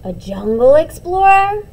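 loud, high, thrilled guess; excited child. Acted. A twin excitedly suggests a jungle explorer. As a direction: call it out like a big adventure.

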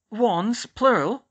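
skeptical. A narrator's reading. Nurse questioning Hermione's request for wands, skeptical. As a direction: question it with pointed disbelief. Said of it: "she said skeptically".

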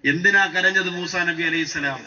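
ordinary talk

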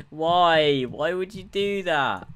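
loud, high, drawn-out protest; high-pitched outraged shout; dismayed yell. Real, not acted. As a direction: wail it in exasperation at the game. Dismay at mishap in game.

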